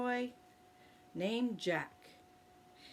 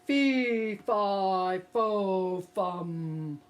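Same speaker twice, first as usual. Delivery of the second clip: booming giant chant, louder; 6 dB louder, much slower than usual. Acted. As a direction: boom it out like a giant. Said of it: "Jack heard a loud voice".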